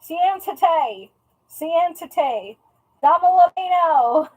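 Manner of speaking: a big Texan butchering Spanish; Texan twang on Spanish commands, slow; Texan accent. Shown as a demonstration. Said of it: "trying to say it like a Texan".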